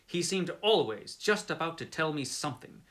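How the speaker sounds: ordinary delivery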